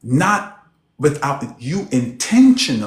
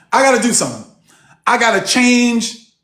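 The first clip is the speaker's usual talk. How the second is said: excited imitation; 6 semitones higher, 4 dB louder than usual. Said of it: "same quiet, meek woman".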